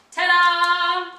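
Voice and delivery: sung high ta-da reveal; bright sung ta-da flourish. Real, not acted. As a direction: sing out the big reveal. Teacher reveals finished drum.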